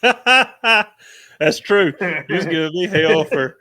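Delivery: loud, high, through real laughter; laughing agreement. Real, not acted. Friend teases the other about tree dog advice, laughing. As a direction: crack up while conceding the point.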